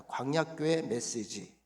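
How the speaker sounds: 광야교회 메시지 drawn out slowly, each syllable spaced; slow call-and-repeat. Shown as a demonstration. Pastor leads the congregation in repeating the title, slow. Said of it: "따라합시다".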